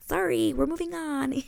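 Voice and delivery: high, sing-song brush-off; playful apology. Real, not acted. Host skipping a dull subplot, playful high apology. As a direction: apologise lightly, pitched well up.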